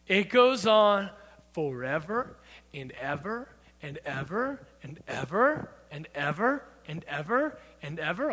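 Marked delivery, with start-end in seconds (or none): 1.5-8.3 "ever" lifted in a rising swoop each time; drawn-out emphasis. Shown as a demonstration. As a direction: repeat "and ever" in low, rising waves, endlessly. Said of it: "emphasizing my point".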